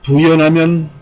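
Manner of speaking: usual speaking voice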